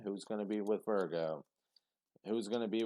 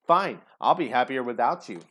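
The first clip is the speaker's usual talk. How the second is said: petty defiance; 3 semitones higher, 10 dB louder than usual. Retold or imitated. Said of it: "kind of feeling".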